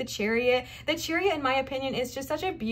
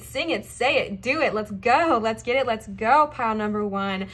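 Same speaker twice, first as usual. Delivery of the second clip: ordinary talk, nothing marked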